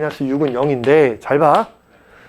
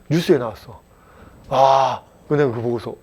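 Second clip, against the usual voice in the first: ordinary talk, nothing marked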